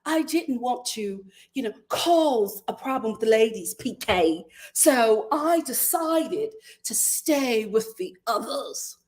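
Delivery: slow, mock posh British accent; a put-on British lady, drawn out; fake British accent. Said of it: "let me do the fake British accent".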